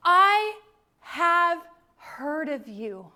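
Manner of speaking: slow and loud, each word weighed; awed loud declaration. Retold or imitated. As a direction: Job proclaiming in wonder, slowly. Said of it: "and he says".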